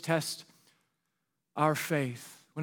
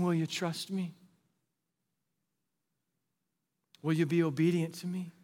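soft, hushed plea; God's quiet call; 2 semitones lower, 5 dB quieter than usual. Acted. God softly calling the listener down the path. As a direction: ask gently, almost under the breath.

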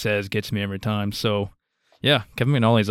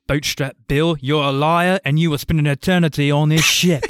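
louder, higher villain impression; Davy Jones; 5 semitones higher, 5 dB louder than usual. Fan quoting a favorite villain line, forceful impression. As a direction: declaim it like a menacing sea villain. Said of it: "My favorite line of his is".

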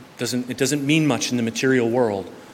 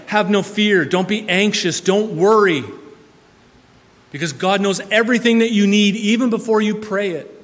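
rising preaching; 7 semitones higher, 4 dB louder than usual. Acted. Preacher exhorting congregation.

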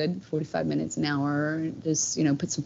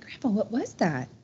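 retold alarm; 5 semitones higher than usual. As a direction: ask as if mildly spooked, reliving it. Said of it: "it scared me".

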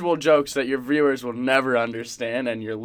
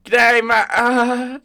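high, loud mock Aussie greeting; badly faked Aussie accent, faltering; attempted Antipodean accent; 12 semitones higher, 6 dB louder, slower than usual. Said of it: "go off in your Aussie accent".

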